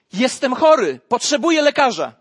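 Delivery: bold admission. Acted. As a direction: say it openly, no shame. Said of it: "nie krępuje się powiedzieć". (człowiek wyznaje chorobę, głośno i śmiało)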